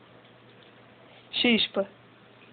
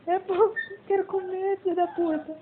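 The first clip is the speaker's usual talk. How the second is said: higher, petulant dog voice; dog's voice; 7 semitones higher than usual. Pretend. Owner speaking for her dog who wants food, petulant high voice. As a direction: grumble as the hungry dog.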